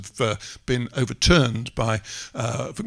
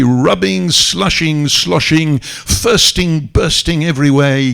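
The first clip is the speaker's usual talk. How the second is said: lively poem recital; 3 semitones higher, 3 dB louder than usual. Acted. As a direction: rattle off the washing verbs with gusto, no Cockney accent. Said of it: "needs to be read in a Cockney accent, which I will not attempt".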